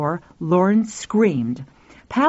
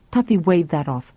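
plain reading voice

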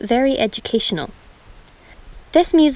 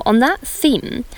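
ordinary delivery

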